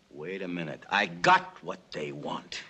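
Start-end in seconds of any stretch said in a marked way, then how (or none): 0.1-0.8 low and soft, musing to himself; the plan forms as he mutters it to himself; quiet realization. Acted. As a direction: let the thought dawn under your breath. Sudden idea, repeated to himself.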